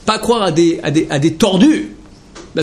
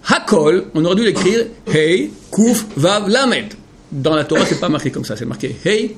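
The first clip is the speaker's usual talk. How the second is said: usual speaking voice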